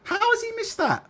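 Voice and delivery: can't believe he missed it, voice up high; incredulous. Real, not acted. Disbelief at reporter missing it.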